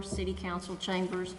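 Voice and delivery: usual speaking voice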